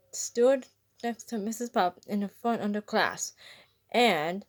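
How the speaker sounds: usual speaking voice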